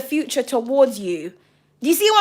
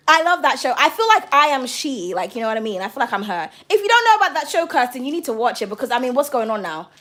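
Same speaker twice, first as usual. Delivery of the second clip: ordinary delivery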